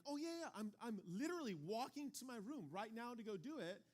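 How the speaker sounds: put-on high, overeager kid voice promising; evasive kid. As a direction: play the dodging kid, way too eager. Kid dodging chore, imitated.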